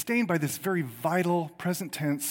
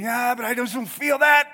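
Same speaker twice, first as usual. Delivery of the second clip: higher, louder protest of a doubter; acted doubter's objection; 7 semitones higher, 5 dB louder than usual. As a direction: push back as a sceptical listener. Voices a doubting listener's objection.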